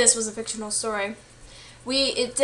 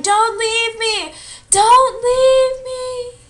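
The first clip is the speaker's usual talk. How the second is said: put-on high, slow, drawn-out wail of a left-behind boy; desperate calling; 11 semitones higher, 7 dB louder, much slower than usual. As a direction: cry it out long and high as he runs. Boy calls after running brother.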